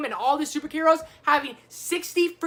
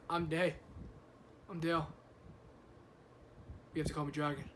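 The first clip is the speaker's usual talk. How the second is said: quoting Step Brothers; 8 semitones lower, 13 dB quieter than usual. Retold or imitated.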